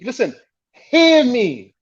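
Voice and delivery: high, drawn-out appeal to be heard; acted spouse's slow, insistent plea; emphatic plea. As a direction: stretch it out and pitch it right up, insisting. Voicing a spouse pleading to be heard.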